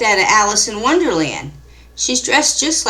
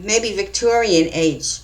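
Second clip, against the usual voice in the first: ordinary delivery, nothing marked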